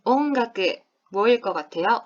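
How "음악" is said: normal talking voice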